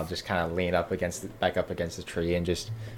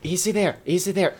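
urgent calming; 9 semitones higher, 5 dB louder than usual. Acted. Calming the frantic squirrel owner.

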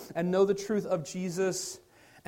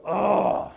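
louder, short fed-up ugh; bothered groan; 7 dB louder than usual. Retold or imitated. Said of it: "makes you go".

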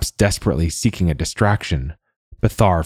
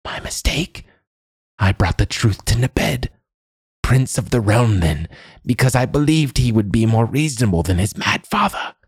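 put-on high old woman, whispery at both ends; regretful old crone; 6 semitones higher than usual. A narrator's reading. Old woman confessing her mistake to the man, high crone voice.

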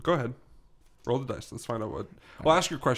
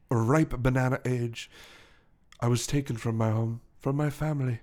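measured storytelling with long pauses; English gentleman gorilla. Acted. Gorilla recounting his past to the detective, measured English voice.

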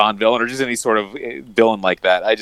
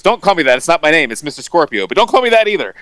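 higher-pitched, louder quoted protest; mock indignant correction; 7 semitones higher, 4 dB louder, faster than usual. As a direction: play at correcting someone indignantly. Quoting an indignant cartoon boss.

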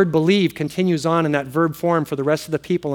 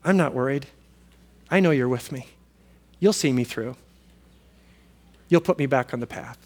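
calm trust; 4 semitones lower, 5 dB quieter than usual. Retold or imitated. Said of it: "you can simply say".

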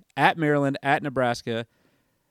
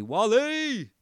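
calling out a name; 9 semitones higher than usual. Acted. Shout out to friend Wally.

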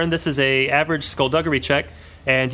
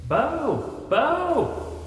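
high, far-off hollered call; distant shouting; 11 semitones higher, 3 dB quieter than usual. Acted. Vern calling down the hallway, distant shout. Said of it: "shouting for Bo".

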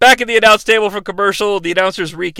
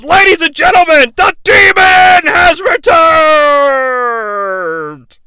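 loud, slow, high announcer call; ring announcer; 7 semitones higher, 5 dB louder, slower than usual. Retold or imitated. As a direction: boom out the big introduction. Announcing the demon's return.

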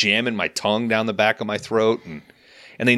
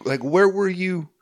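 high, gushing mock delight; lightly hammed relief and joy; 10 semitones higher than usual. Praising the gentle new hygienist, high and gushing.

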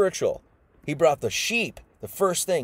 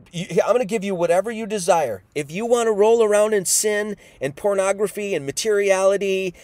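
higher, lightly acted permissive challenge; God granting free rein; 6 semitones higher, 4 dB louder than usual. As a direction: dare them to go ahead, building louder. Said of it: "God is saying".

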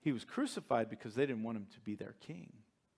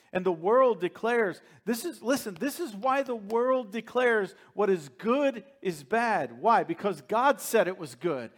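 higher, louder impassioned preaching; fiery preaching; 7 semitones higher, 7 dB louder than usual. Acted. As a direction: drive it home to the congregation. Preaching at full force.

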